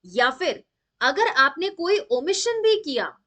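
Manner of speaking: ordinary talk, nothing marked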